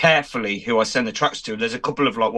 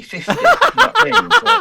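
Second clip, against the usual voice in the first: laughing; 9 dB louder than usual. Real, not acted. Laughing at own joke.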